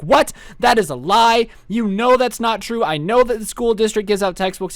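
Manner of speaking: hammed-up indignant denial; mock indignation. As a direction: protest as if called a liar, acted. Substitute disputes the dean.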